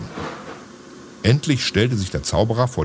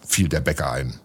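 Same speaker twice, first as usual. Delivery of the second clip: usual speaking voice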